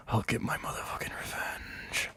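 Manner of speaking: hushed, unvoiced vow of revenge; whispered threat. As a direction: whisper the grudge under your breath. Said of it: "And Monster Stein's like".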